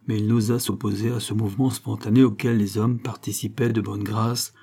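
ordinary delivery, nothing marked

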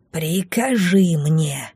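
медленно, хрипло, полушёпотом; протяни хрипло и таинственно; хриплый шёпот. A narrator's reading. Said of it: "хриплым шёпотом сказал". (хриплым шёпотом, медленно)

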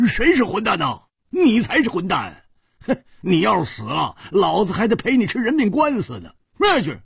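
high-pitched indignant tirade; angry cursing. A narrator's reading. Carriage driver cursing the man he ran over, furious. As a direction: curse him out, pitch way up. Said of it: "他大骂道".

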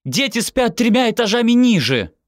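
high-pitched, loud protest; indignant shout. A narrator's reading. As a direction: yell it in exasperation, pitch right up. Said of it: "закричала она".

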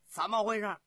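loud, high, abrupt demand; shouted challenge. Acted. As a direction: bellow the question as a commander. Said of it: "喝完". (高声喝问)

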